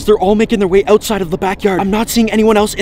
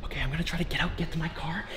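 low trembling whisper; scared whisper; 7 semitones lower, 10 dB quieter than usual. Acted. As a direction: whisper in dread. Alone in dark house, scared.

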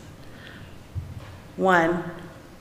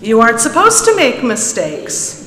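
stern teacher; 6 semitones higher, 5 dB louder than usual. A narrator's reading. Stern teacher scolding the boy.

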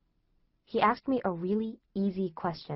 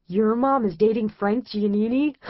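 louder, amused jeering brother; mocking disbelief; 6 dB louder than usual. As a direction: laugh it out in delighted disbelief. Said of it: "started laughing his head off".

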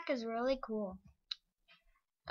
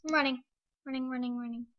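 panicked; 3 semitones higher, 7 dB louder, faster than usual. Real, not acted. Fleeing attacker in game.

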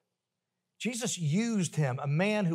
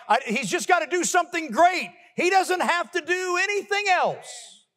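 much higher, climbing preacher cry; impassioned preaching; 12 semitones higher, 3 dB louder than usual. Acted. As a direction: lift it to a fervent peak. Preaching climax, rising pitch.

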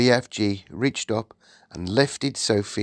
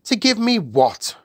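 high, louder royal query; puzzled Queen; 12 semitones higher, 5 dB louder than usual. Acted. Queen questions the giant, puzzled and high. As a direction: question it in a raised regal voice.

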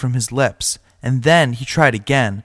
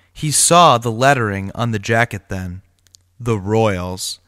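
plain reading voice

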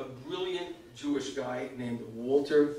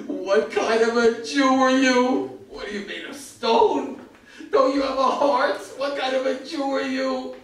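louder, slow mock yell of outrage; imitating an indignant scream, higher; retold scream; 10 semitones higher, 7 dB louder, slower than usual. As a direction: hurl the accusation slowly. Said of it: "started screaming at me".

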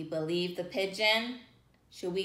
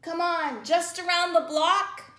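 pleading pigeon; 9 semitones higher, 4 dB louder than usual. Acted.

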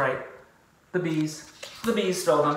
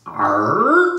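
pirate arr; 2 semitones higher, 7 dB louder than usual. Acted. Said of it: "said another".

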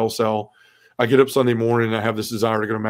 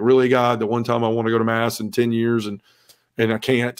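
ordinary delivery

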